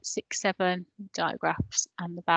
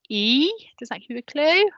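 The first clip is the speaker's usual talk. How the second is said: ordinary talk, nothing marked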